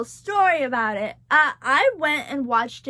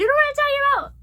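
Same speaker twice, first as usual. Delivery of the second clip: fast, high, louder gushing; excited; 12 semitones higher, 6 dB louder, much faster than usual. Real, not acted. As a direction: rush it out in delight. Excited gushing about intro.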